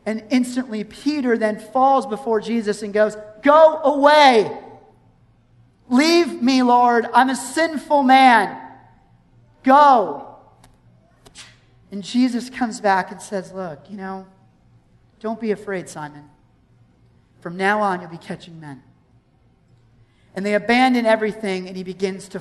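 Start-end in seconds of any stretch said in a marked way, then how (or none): 3.4-10.3 slow, raised-voice dismissal; anguished plea. Acted. Peter falls before Jesus, begging him to go, anguished. 13.5-18.8 soft, lower, soothing voice; gentle reassurance. Acted. Jesus reassuring a fearful Simon, gently.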